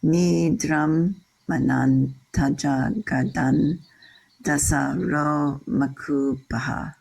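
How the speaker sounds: slow measured chant of "Nidram manantaja gadan", long syllables drawn out; sing-songy Sanskrit. Shown as a demonstration. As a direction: recite "Nidram manantaja gadan" in strict long-short meter. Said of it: "sing-songy Sanskrit".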